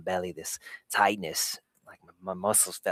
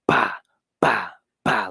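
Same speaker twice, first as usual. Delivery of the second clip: heartbeat imitation; 8 dB louder, slower than usual. Shown as a demonstration. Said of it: "it's beating hard".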